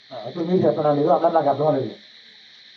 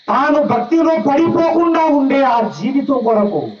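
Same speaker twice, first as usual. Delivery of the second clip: building sermon; 4 semitones higher than usual. Acted. Preaching on Christ's tears.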